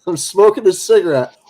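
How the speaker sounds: normal talking voice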